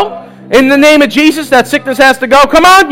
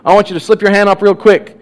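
soft, lower, hushed invitation; altar call; 6 semitones lower, 3 dB quieter than usual. Real, not acted. Pastor quietly inviting people to raise hands. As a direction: invite them gently, heads bowed.

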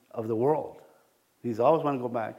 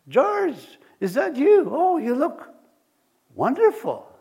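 mock delighted greeting; 11 semitones higher, 5 dB louder, slower than usual. Mistaken greeting, overjoyed.

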